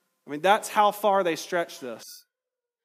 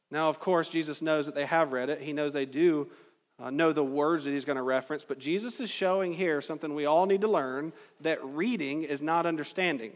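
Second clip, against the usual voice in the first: ordinary talk, nothing marked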